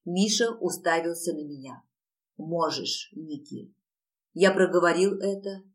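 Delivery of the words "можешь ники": very soft, heavy answer; hushed, as if it hurts to say; pained consent. A narrator's reading. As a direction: let the words go reluctantly, fading away. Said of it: "слова расплавленным свинцом лились по моему горлу".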